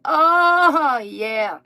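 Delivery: loud high burst of delight; excited yelp. Retold or imitated. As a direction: blurt it out, thrilled. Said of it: "I was like".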